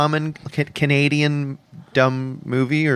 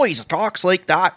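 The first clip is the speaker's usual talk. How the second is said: Doug Ford accent impression; 4 semitones higher than usual. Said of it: "has a hell of an accent".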